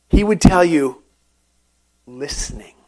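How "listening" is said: low, soft, falling reveal; hushed answer. Acted. Preacher reveals the climber's key to the congregation, hushed. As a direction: say it quietly, voice dropped low.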